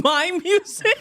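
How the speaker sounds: high, slow, disbelieving question; incredulous. Retold or imitated. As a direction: ask it high, genuinely baffled. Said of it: "And I was like".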